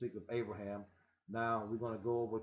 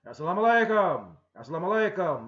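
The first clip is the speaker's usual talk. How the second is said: loud, higher commanding greeting; voices of authority; 6 semitones higher, 12 dB louder, faster than usual. Acted. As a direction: greet with otherworldly power. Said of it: "maybe with deep voices of authority".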